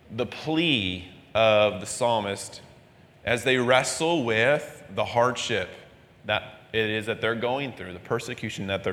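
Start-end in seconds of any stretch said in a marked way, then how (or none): none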